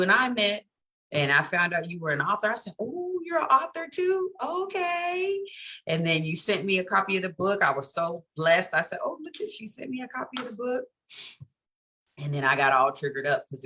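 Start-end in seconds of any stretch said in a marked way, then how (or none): 2.8-5.4 high, bright surprise at meeting an author; high excited gush. Retold or imitated. 9.0-10.9 delighted. Retold or imitated. Retelling delight at gift.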